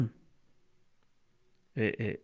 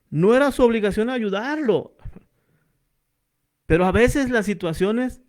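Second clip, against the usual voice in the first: raised, urgent sermon delivery, higher in pitch; fervent preaching; 3 semitones higher than usual. Acted. Pastor urging the brothers not to pass by, fervent preaching. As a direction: drive the lesson home to the congregation.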